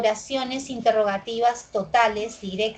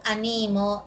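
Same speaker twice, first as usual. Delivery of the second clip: "animo" stressed on NI, a-NI-mo; stress on middle syllable. Shown as a demonstration. Teacher contrasting accents for students, "animo" stressed mid-word. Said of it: "aquí observamos oposición del acento".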